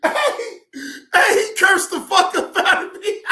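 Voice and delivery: loud, high, tickled exclamation; cracking up as you shout it; laughing hard. Real, not acted. Streamer amused at being cursed out, laughing hard. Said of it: "I was able to laugh".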